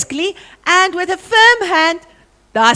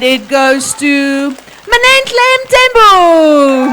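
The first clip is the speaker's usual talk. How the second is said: slow, drawn-out winner reveal, a little louder; drum roll announcement; 5 dB louder, slower than usual. Shown as a demonstration. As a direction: stretch the name like a prize announcer. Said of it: "give me a drum roll".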